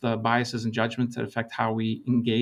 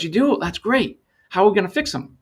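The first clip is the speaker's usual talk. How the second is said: higher, louder, keen and hopeful; eager surprise; 8 semitones higher, 5 dB louder than usual. Retold or imitated. Manager reacting eagerly to the consultant, excited and higher. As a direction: jump at the good news.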